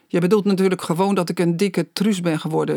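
plain reading voice

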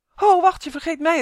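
high, loud call after someone running off. Acted. Elf calling after the fleeing boy, loud and high. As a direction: shout it high so he hears you. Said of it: "riep Uf".

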